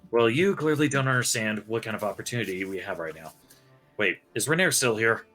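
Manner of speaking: ordinary delivery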